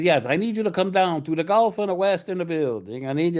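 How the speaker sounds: put-on high Italian accent, extra vowels between words; Dino De Laurentiis. Producer summons writer to his office, high Italian accent. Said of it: "when you do the accent".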